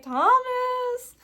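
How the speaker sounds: high, drawn-out teasing name-call. Retold or imitated. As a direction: tease a friend about a crush, sing-song high. Teasing idol about his crush.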